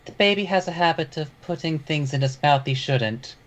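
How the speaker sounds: a hint of a woman's voice. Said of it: "in a woman's voice".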